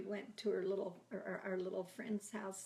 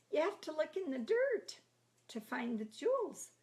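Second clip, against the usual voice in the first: yelling up; 8 semitones higher, 5 dB louder than usual. Retold or imitated. Siblings yell up the ladder.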